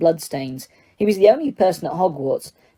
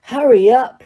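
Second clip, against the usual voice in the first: moaning; 7 semitones higher, 6 dB louder than usual. Acted. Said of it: "Ron moaned".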